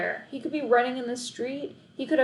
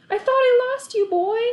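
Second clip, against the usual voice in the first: high, loud relieved cry; boy hugging his found dog, tearful; 8 dB louder than usual. A narrator's reading. Hugging found dog, eyes watered.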